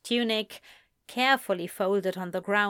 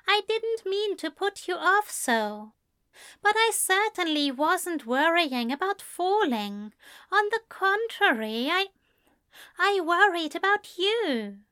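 high, earnest pleading; pleading angel; 9 semitones higher than usual. A narrator's reading. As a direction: plead with him. Aziraphale explains.

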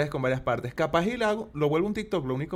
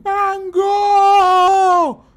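high, loud drawn-out yell; retold shout; 13 dB louder than usual. Retelling a stranger calling out his name, loud shout. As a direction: holler the name across the street. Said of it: "que juraría que gritaron".

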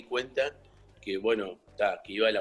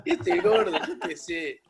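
laughing imitation; 8 semitones higher, 4 dB louder than usual. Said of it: "Decía".

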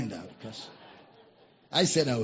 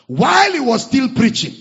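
loud burst; 7 semitones higher, 10 dB louder than usual. Real, not acted. Preacher surge.

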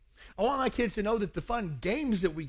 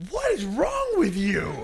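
exasperated wife; 7 semitones higher, 8 dB louder, slower than usual. Retold or imitated. Wife confronts him in bedroom.

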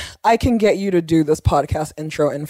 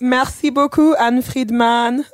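hammy French thank-you; mock French flourish, high; French accent; 4 semitones higher, slower than usual.

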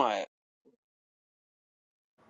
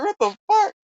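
very high, loud mock panic; hammed-up high, loud disbelief; spooked outburst; 8 dB louder than usual. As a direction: yelp it like a retold fright. Retold spooked outburst at the spirit.

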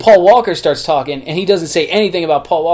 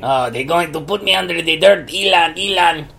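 Elon voice. Retold or imitated. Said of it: "the Elon monster's here".

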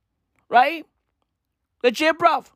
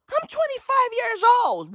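much higher, put-on prissy voice; mocking Karen voice; 13 semitones higher than usual. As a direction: ape her claim in a silly high voice. Parodying the heckler.

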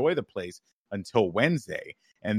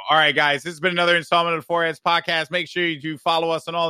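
podcast outro; 6 semitones higher, 4 dB louder than usual. Real, not acted. Outro sign-off.

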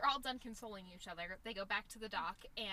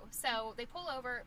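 ordinary talk

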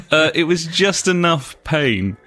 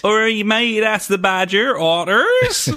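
broad Bristol yokel voice, high; mock West Country greeting; Bristol accent; 12 semitones higher, slower than usual. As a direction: ham up a Bristolian local. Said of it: "don't talk like that".

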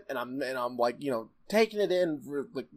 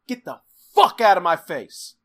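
mock furious send-off, louder; angry dismissal; 7 dB louder than usual. Boss dismissing the man who lost his changer, acted anger. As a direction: throw him out with a loud, punched curse. Dabu angrily dismisses him, retold.

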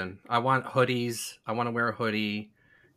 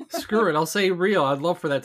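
loud, high, giddy give-up; excited outburst; 6 semitones higher, 7 dB louder than usual. Real, not acted. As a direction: throw caution to the wind, loudly. Gives up and picks real loudly.

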